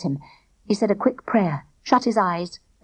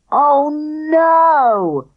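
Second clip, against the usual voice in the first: high, loud wailing groan; boy groaning at the ruined day; 6 semitones higher, 5 dB louder than usual. A narrator's reading. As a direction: wail in despair. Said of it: "groaned Spag".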